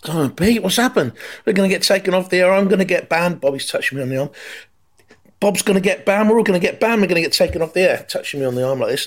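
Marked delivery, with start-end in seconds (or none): 0.1-3.4 much higher, frantic pleading; high rushed panicky voice. Retold or imitated. Panicking on air. 5.4-8.0 much higher, frantic pleading; high rushed panicky voice. Retold or imitated. Panicking on air.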